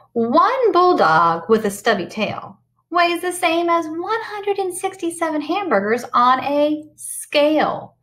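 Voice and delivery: lively storybook read-aloud; sing-song picture book voice; reading to children. Acted. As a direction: read it bright and animated for the kids.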